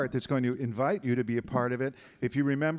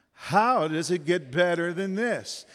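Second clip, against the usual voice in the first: slow, higher, louder chant; emphatic repetition; 4 semitones higher, 5 dB louder, slower than usual. Shown as a demonstration. Speaker urging the room to repeat, slow emphatic declaring.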